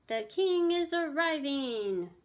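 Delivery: high, loud, drawn-out cry; yelled announcement. A narrator's reading. Host warning the guests of the king, yelling. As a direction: yell it out like a herald. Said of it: "yelled Circumference".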